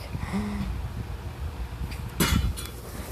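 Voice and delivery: a quick, airy gasp of realization; sharp gasp. Retold or imitated. Denki suddenly remembering, a sharp gasp. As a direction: suck in breath as it clicks. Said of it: "and he was like".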